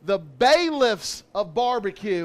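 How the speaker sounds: booming hype host intro; jolly loud grill dad. Acted. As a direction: shout out the hosts.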